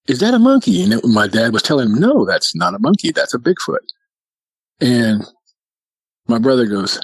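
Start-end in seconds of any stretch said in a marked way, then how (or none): 0.1-0.8 put-on high, puzzled child voice; confused little brother. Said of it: "my little brother's confused". 2.0-3.8 lightly voiced insistent father, pitch raised; a hint of emphatic dad; dad correcting son. Voicing Dad correcting the confused son.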